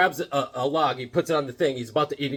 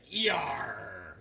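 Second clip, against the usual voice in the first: low, soft drawn-out growl; Thanos growl; 4 semitones lower, 11 dB quieter than usual. Retold or imitated. Host voicing a comic panel, soft low growl. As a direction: lightly growl it out.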